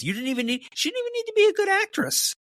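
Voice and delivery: shooting up high, fast and incredulous; exasperated. Real, not acted. Host mocking the actress's weak accent, high and fast. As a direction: throw up your hands in disbelief.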